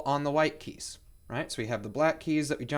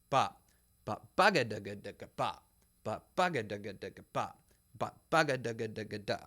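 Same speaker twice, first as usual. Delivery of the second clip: sung rhythm with a gentle press on each downbeat; accented downbeats; 5 semitones lower, faster than usual. Shown as a demonstration. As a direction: press the first beat, let the rest fall away. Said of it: "we need to make sure that we accent the, uh, downbeats".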